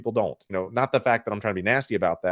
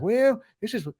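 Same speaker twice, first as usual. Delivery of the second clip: high, hammed-up elderly collector; put-on old man voice, pitched way up; 8 semitones higher than usual. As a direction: show off a prized piece as a high-voiced old-timer. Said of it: "let's say you're eighty".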